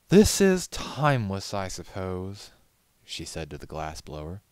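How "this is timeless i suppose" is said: high, louder grudging concession; cool aristocratic disdain; haughty. A narrator's reading. Rarity haughty again.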